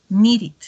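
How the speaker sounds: "needed" with a soft tapped ending, said "niri"; "needed" con final suave. Shown as a demonstration. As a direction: end "needed" gently on "id". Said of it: "Porque el sonido es vocálico".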